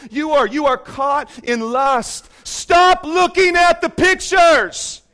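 loud, slow, high call; fervent exhortation. Real, not acted. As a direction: urge them to rise, hammering each word. Preacher calls congregation to act.

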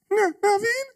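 high, frightened little squeak; scared squeak. Retold or imitated. Young tenant answering the biker, high scared squeak. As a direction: squeak it out like a terrified kid.

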